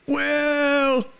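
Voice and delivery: put-on high, creaky old codger; old man voice. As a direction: play a rickety old timer. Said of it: "is that the old man voice".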